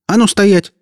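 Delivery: voiced girl barking a stop order; barked command. A narrator's reading. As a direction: snap the order out like a slap. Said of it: "рявкнула Оля".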